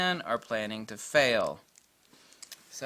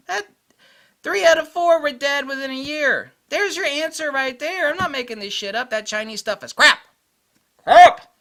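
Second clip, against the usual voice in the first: high, very loud exasperated shouting; ranting yell; 14 semitones higher, 13 dB louder than usual. Real, not acted. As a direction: build to one explosive final shout. Climax of his rant.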